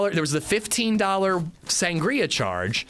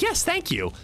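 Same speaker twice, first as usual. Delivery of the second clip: mock wife voice; 14 semitones higher than usual. Wife accepting sangria offer.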